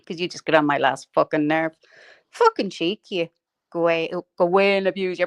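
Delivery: ordinary talk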